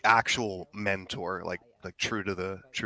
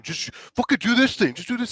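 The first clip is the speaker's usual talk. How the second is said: high, loud imitation of frustration; mock angry venting; 13 semitones higher, 6 dB louder than usual. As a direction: imitate someone venting anger at teammates. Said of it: "getting their anger out of people".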